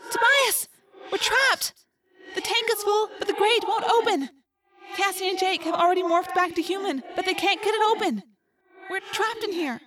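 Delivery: put-on high, distant trapped girl; faint, far-away voice. A narrator's reading. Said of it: "It was faint, as if it were far away".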